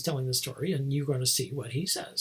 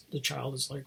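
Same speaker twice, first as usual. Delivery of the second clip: usual speaking voice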